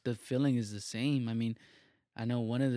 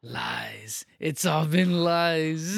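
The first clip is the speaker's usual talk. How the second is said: mock betrayed; 4 semitones higher, 7 dB louder, much slower than usual. Mock dramatic betrayal over Santa.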